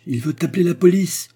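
high, loud outburst; outraged high exclamation; sudden cry. A narrator's reading. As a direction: cry out in alarm. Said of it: "cria soudain la baronne".

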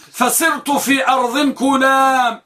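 كلام said kulam, u after the k; kulam with damma. Shown as a demonstration. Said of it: "بضم الكاف".

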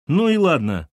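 high, loud, angry "Ну и ладно"; spiteful boy. Acted. Boy storming off from mum, spiteful. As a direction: spit it out in spite. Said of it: "злобно сказал мальчишка".

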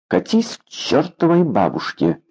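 дерзкий отпор. A narrator's reading. Дерзкий ответ на приказ. (дерзко, повыше тоном)

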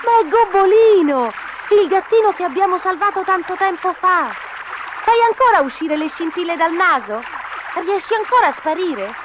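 put-on high, animated kids' voices; excited children's cries. A narrator's reading. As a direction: exclaim and question like thrilled children. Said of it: "gridarono in coro".

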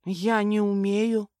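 slow, timid little voice; timid admission. A narrator's reading. Captive woman admitting she cannot drive, timid. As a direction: confess it haltingly and sheepishly.

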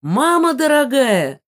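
high, loud, drawn-out wail; panicked guests' scream. A narrator's reading. Guests scream as the chandelier rattles overhead, high slow wail. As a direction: scream it out in shock. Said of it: "закричали гости".

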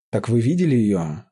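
usual speaking voice

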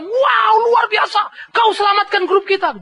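high, loud amazed praise; amazed sergeant. Retold or imitated. Sergeant praising the soldier, high and loud. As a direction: sergeant marvelling at his saviour.

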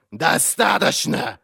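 high, drawn-out bark; barked order. A narrator's reading. Inquisitor silencing the raving prisoner, barking. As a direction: cut in with a forceful bark. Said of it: "рявкнул Глокта".

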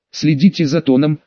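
ordinary delivery, nothing marked

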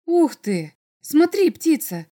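loud, high burst on the middle word; excited wonder. Acted. Boy pointing something out to bird girl, excited wonder. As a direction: call out in sudden boyish amazement.

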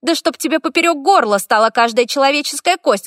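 high, loud furious cursing; furious curse. A narrator's reading. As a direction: spit the curse at him.

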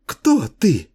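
high, childish mocking echo; mocking mimicry. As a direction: parrot him back in a girlish voice. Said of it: "передразнила она его".

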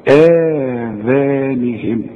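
آذانهم, the opening آ held long and slow; natural madd. Shown as a demonstration. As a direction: draw out the آ in آذانهم. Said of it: "مد طبيعي".

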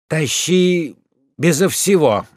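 firm, decisive order. Acted. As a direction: settle it firmly. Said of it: "решительно говорит Уклейкин".

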